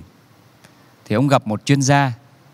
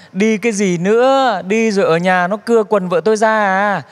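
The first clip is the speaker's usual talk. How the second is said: furious shout; 6 semitones higher than usual. Retold or imitated. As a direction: bark at the driver to turn back. Furious husband orders driver home.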